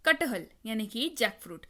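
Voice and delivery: ordinary delivery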